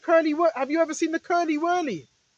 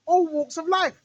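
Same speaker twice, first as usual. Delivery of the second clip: usual speaking voice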